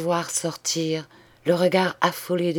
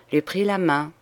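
usual speaking voice

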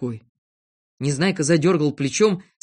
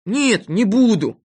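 slow, loud, sulky promise; grumpy boy, pitched up and loud; sullen grumble; 7 semitones higher, 7 dB louder, slower than usual. A narrator's reading. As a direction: give in reluctantly, dragging it out. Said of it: "угрюмо буркнул Незнайка".

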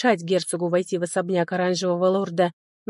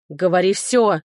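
потребовал; 5 dB louder than usual. Acted. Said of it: "потребовал он".

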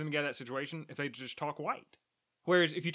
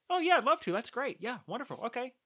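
higher, eager-to-please fake cheer; higher voice put on at work to seem agreeable; 8 semitones higher than usual. Shown as a demonstration. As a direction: brightly placate someone to escape them. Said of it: "a little bit of a higher voice just to be agreeable".

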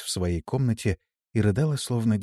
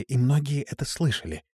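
ordinary delivery, nothing marked

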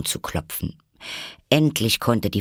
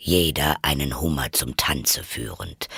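low creature voice; Griffin interjecting; 5 semitones lower than usual. Acted. Griffin adding to the dance steps, low character voice. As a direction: chime in, pitched well down. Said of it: "rief der Greif".

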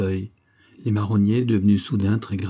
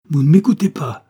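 higher, much louder furious outburst; angry cry; 6 semitones higher, 8 dB louder than usual. A narrator's reading. Grepnel, ignored by Dora, cries out in anger. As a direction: shout it, stung that she ignores him. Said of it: "cria Grepnel avec colère".

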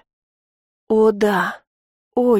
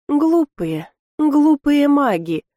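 higher, slow, sneering taunt; mock the foolish mages from inside her head; nasty giggling voice in her head; 6 semitones higher, much slower than usual. A narrator's reading. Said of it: "Мерзко хихикнули у меня в голове". (высоким медленным глумливым голосом)

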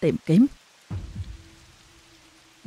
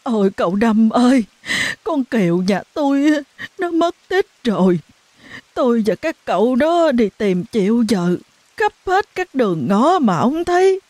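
tearful wailing; 6 semitones higher than usual. A narrator's reading. Mother telling neighbour her daughter vanished, tearful. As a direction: wail it out in distress. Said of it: "mếu máo khóc".

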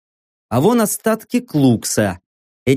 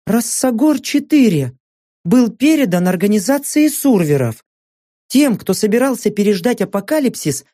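higher-pitched female character voice; Saka, emotional companion; 6 semitones higher than usual. Acted. Saka telling narrator about the island's past, higher earnest voice. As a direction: voice an earnest woman recalling old news, pitched up.

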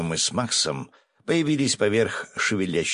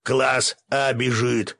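drawn-out, higher-pitched alarm call; yelling; 6 semitones higher, 3 dB louder, much slower than usual. A narrator's reading. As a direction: yell a slow warning. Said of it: "заорал мой помощник". (протяжный крик тревоги)

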